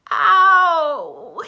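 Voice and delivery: sharp, very loud high cry; gasped. A narrator's reading. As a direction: yelp in pain. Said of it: "he gasped".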